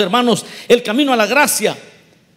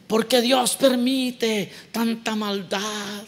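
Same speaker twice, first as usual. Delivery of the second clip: mocking skeptic; 5 dB quieter, much slower than usual. Skeptics' complaint, mocked.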